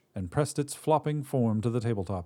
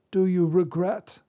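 put-on old Drea, gentler; 10 semitones higher, 4 dB louder than usual. Old witch crouches by the god and asks, high. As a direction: ask it like a weary crone.

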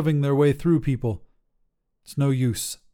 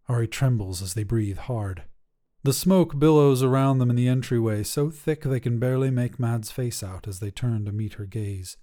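plain reading voice

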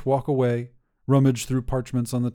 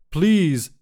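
called out louder and higher, begging; pleading call; 5 semitones higher, 6 dB louder than usual. A narrator's reading. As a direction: call after her as the door shuts. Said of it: "Petros calls".

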